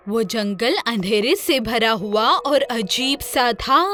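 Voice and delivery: plain reading voice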